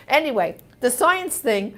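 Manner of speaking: ordinary talk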